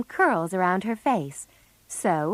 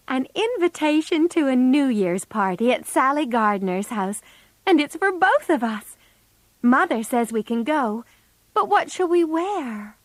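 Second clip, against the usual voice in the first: excited; 6 semitones higher, 3 dB louder than usual. A narrator's reading. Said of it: "said Meg excitedly".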